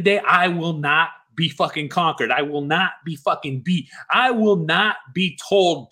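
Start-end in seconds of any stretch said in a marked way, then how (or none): none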